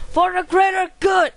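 battle cry. Pretend. Gamer rallying himself for the level, shouting. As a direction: yell it like charging into battle. Gamer repeats the title, yelling.

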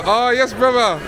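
usual speaking voice

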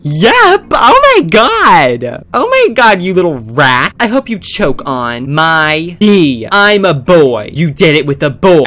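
loud, drawn-out outraged yelling; furious yell. Pretend. Girlfriend character yelling at cheating Chris, loud furious yell. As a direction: scream at the little rat.

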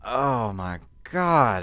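slow pained oh my God; pained groan. Real, not acted. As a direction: groan it out through the hurt. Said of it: "it hurts so bad".

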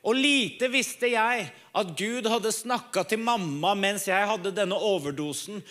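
usual speaking voice

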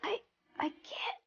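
faint, voiceless attempt to speak; voice giving out. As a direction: try to talk but barely make a sound. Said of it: "I can hardly talk".

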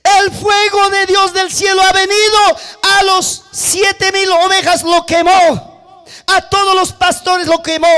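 loud, high, urgent report; messenger's alarmed cry. Acted. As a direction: cry out the disaster to your master. Messenger cries out disaster to Job. (grito alarmado de mensajero)

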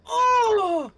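sudden high yelp of surprise; startled shout. Real, not acted. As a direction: jolt at a sudden scare. Killer suddenly appears.

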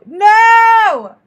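loud, high, stretched NO; cringing outburst; horrified cry of no. Real, not acted. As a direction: wail no in dismay. Cringing reaction to the clip.

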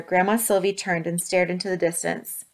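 ordinary delivery

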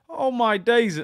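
high, loud exclamation of shock; astonished. Real, not acted. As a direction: exclaim it, truly floored. Realising he was wrong.

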